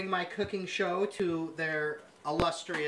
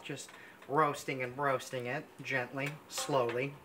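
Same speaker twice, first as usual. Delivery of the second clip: ordinary delivery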